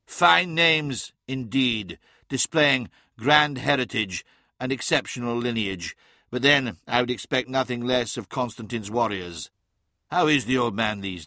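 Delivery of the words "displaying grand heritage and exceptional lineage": plain reading voice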